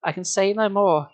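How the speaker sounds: ordinary delivery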